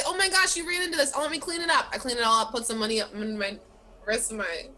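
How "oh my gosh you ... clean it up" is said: high, sweet fake apology; fake innocent. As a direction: play it innocent, much higher. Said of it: "I say".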